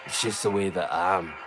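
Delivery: low, menacing Glasgow growl; deep Glaswegian hard man. Shown as a demonstration. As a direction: sound like he'll break your legs, Glaswegian. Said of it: "This way sounds like somebody that's gonna fuck you up".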